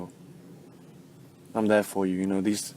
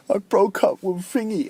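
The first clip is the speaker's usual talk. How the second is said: upset friend; 11 semitones higher than usual. Retold or imitated. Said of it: "then they're like".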